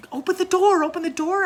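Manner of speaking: put-on a child pleads with her siblings, yelling in alarm; retold yell. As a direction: yell from behind the others to let him out. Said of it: "I was saying from behind them".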